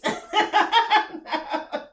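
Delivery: high, loud laughing burst; loud, high laugh at her own clumsy accent; burst of laughter. Real, not acted. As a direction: crack up at yourself.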